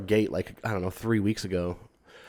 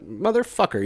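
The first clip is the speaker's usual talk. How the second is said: put-on much higher, small-child voice; little kid swearing; 8 semitones higher than usual. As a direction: imitate a toddler blurting it out. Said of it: "a young kid be like".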